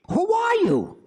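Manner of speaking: high, loud startled question. Acted. Professor startled by a stranger at night, high and loud. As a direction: jump at the sight of her.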